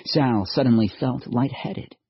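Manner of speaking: plain reading voice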